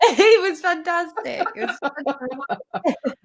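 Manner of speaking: slow words broken up by laughter; laughing praise. Real, not acted. Coach cheering the host's attempt, laughing praise. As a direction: praise through real giggles, starting high.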